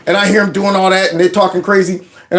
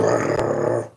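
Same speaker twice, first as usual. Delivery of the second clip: low soft dog growl; pit bull growl; 9 dB quieter than usual. Retold or imitated. A pit bull growling backup, low and soft. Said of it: "you got a pit bull going".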